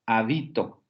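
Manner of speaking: «Habito» pronunciado ha-BI-to; acento en BI. Shown as a demonstration. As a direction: marca la sílaba media de «Habito». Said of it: "con el acento en bi". («Habito» llana, golpe en BI)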